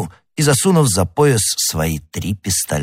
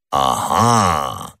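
long drawn-out, low "aha"; drawling; 6 semitones lower than usual. A narrator's reading. Musketeer catches on to his friends' news, drawling. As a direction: stretch out the word knowingly. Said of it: "протянул Портос".

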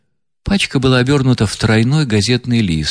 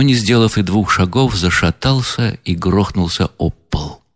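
ordinary delivery

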